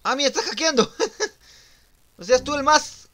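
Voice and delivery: loud, high, amused shout; laughing outburst. Real, not acted. Player accusing someone of hacking, laughing shout.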